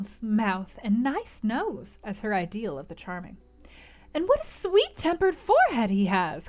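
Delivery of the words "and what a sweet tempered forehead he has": put-on high, gushing young lady; gushing Louisa. A narrator's reading. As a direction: coo it over him, high and adoring. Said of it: "cried Louisa".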